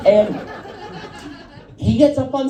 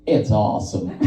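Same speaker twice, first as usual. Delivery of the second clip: imitated driver, dropped way down in pitch; low, satisfied deadpan; 13 semitones lower than usual. Driver answering the comic about the nail salon, low deadpan. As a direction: let it sink low as a matter-of-fact punchline.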